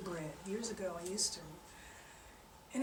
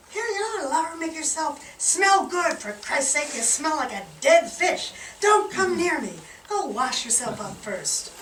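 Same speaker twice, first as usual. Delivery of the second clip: high, loud disgusted scolding; scolding wife; 6 semitones higher, 8 dB louder than usual. Acted. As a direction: push him away with disgust. Wife scolding smelly fisherman.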